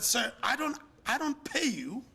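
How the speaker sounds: high, bold arrogant retort. Retold or imitated. To his president, an arrogant high retort. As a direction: talk down to him like his boss. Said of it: "how arrogant we can get".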